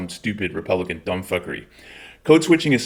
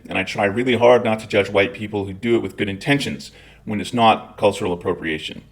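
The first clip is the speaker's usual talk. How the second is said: ordinary delivery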